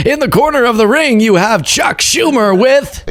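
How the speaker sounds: high, booming mock fight announcer; boxing ring announcer. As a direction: hype the crowd like a ring announcer.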